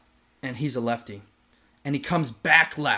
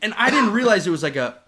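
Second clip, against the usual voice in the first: laughing; 8 semitones higher, 8 dB louder than usual. Real, not acted. Laughing at the doctor visit.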